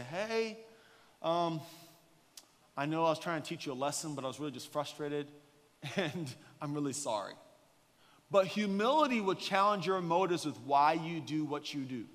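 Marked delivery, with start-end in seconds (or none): 0.0-7.4 soft apology. Retold or imitated. Apology to kid.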